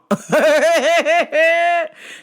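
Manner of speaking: long, high burst of laughter; loud high cackle. Real, not acted. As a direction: cackle loudly at your own story. Laughs at own story.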